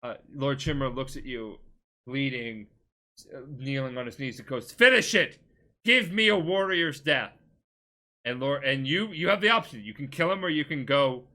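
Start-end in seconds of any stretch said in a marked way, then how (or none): 4.7-7.3 loud, high-pitched acted cry; dramatic retold demand. Defeated lord kneeling before his nephew, shouting a demand. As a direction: shout it like a warrior demanding the end. Beaten uncle demands death.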